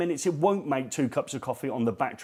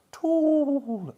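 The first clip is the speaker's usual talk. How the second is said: tool call; 12 semitones higher than usual. Shown as a demonstration. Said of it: "say it in some sort of broken Italian accent".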